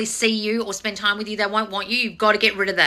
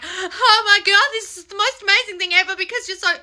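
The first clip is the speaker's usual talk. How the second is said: mock swooning; 9 semitones higher than usual. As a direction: overdo the lovestruck fan. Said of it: "don't be like".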